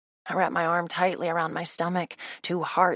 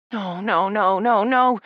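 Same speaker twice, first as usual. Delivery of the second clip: rising panic, growing louder; panicked pleading that climbs louder each time; 3 semitones higher, 4 dB louder, slower than usual. Acted. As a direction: build from fear to frantic. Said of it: "My voice is full of panic".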